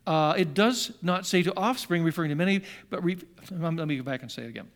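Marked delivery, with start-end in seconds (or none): none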